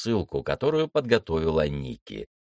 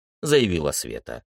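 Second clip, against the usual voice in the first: plain reading voice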